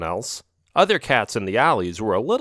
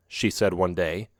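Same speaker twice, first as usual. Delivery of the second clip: ordinary delivery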